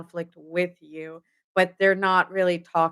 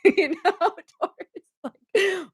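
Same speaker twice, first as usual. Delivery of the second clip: laughing; 12 semitones higher than usual. Real, not acted. Laughing at own joke.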